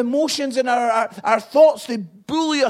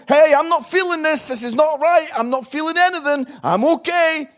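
loud, hammed-up "I'm fine" act; pretending nothing is wrong, hammed up; mock brave face; 7 semitones higher, 6 dB louder than usual. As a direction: fake it's-all-fine cheer, overdone. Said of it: "if you try and pretend".

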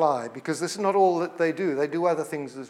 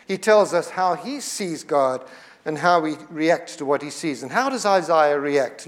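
ordinary delivery

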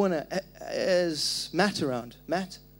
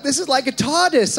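high, loud retold amazement, no put-on accent; amazed visitor; 9 semitones higher, 9 dB louder than usual. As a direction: exclaim it like a wowed visitor. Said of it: "I don't know why that was Australian".

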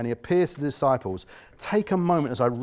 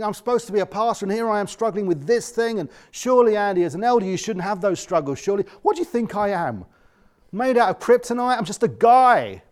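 higher, half-mocking inner voice; mock his own guilty thoughts; exasperated aside to himself; exasperated self-talk; 6 semitones higher than usual.

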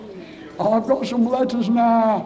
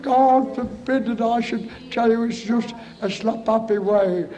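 usual speaking voice

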